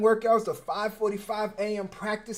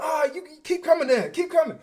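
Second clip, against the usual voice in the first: taunting; 10 semitones higher, 6 dB louder than usual. Retold or imitated. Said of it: "I was like".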